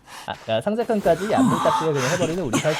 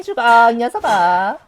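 menacing taunt; 7 semitones higher, 8 dB louder, much slower than usual. Acted. Killer taunts survivor.

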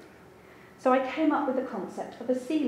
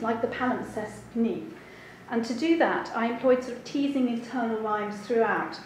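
usual speaking voice

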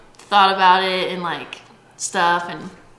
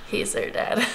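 laughing; 9 dB quieter than usual. Real, not acted. Laughing aside about dogs.

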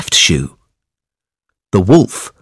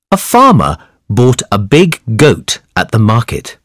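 plain reading voice

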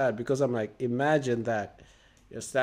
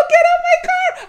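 hammed-up shriek, much higher and louder; mock scream; 13 dB louder than usual. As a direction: scream it like someone is jumping on your car. Acting woman attacked on car.

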